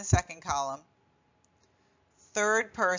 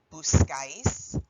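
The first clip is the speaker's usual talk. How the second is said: buscáis said slowly, stress landing on the áis; buscáis with the accent clearly on the last syllable; slow stressed ending; 3 semitones lower, 3 dB louder, slower than usual. Shown as a demonstration. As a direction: draw out buscáis and lean on the final syllable. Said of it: "don't forget that accent".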